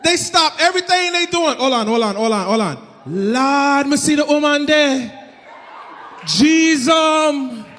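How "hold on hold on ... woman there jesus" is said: mock Jamaican accent, awestruck. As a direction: gawk out loud in a Jamaican accent. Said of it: "like a Jamaican man".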